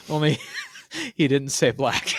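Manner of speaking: laughing. Real, not acted. Laughing while correcting the quote.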